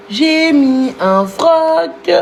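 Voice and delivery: slowly, stretching each word; slow and deliberate. Acted. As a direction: drag out every syllable. Repeats line slowly for effect.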